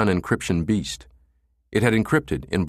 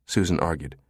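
usual speaking voice

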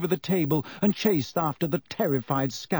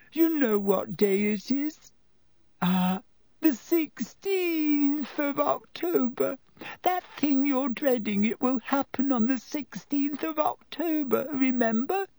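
tearful wail; 11 semitones higher than usual. A narrator's reading. Lavender to Hermione about her rabbit, crying wail. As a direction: sob it out in grief. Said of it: "said Lavender tragically".

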